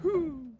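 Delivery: high, loud exhale of relief; loud relieved whew. Real, not acted. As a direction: blow out the tension. Relief after scary moment.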